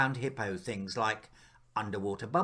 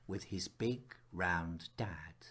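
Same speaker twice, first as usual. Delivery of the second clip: soft unvoiced whisper, slowed; whispered wish; 7 semitones lower, 10 dB quieter, slower than usual. A narrator's reading. Narrator whispering a child's wish for his dad. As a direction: breathe it out like a secret longing. Tender wish at story's end.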